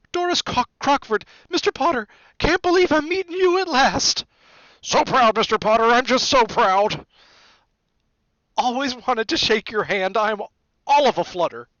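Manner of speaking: very high, loud gushing woman; fluttering admirer. A narrator's reading. Doris Crockford shaking Harry's hand, high gushing flutter. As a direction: gush in an excited high voice. Fans mob Harry to shake hands.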